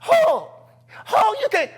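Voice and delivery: high, loud wail of objection; very high mock pleading; mom's protest. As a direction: imitate a distressed mother. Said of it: "I can hear my mom going".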